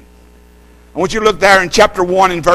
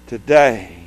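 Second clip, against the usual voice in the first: ordinary talk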